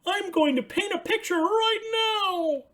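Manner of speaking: high, bright puppet voice for Ernie; excited high Ernie calling out. Acted. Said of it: "he said".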